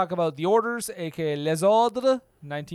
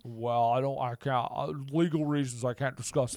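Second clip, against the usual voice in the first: put-on halting old movie star, lower; Jimmy Stewart; 5 semitones lower, 4 dB quieter than usual. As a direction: stammer evasively as an old Hollywood actor. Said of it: "Jimmy Stewart?".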